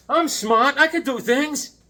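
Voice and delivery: high, loud whiny Fredo imitation; Fredo from Godfather. Vlogger mocks Fredo proving himself, high loud whine. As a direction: pipe up like a wounded little brother insisting. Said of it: "Not that Fredo".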